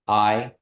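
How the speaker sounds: ah sliding into e; teacher models the ai diphthong; Kiowa I. Shown as a demonstration. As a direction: say I as ah plus e. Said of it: "they make the sound I".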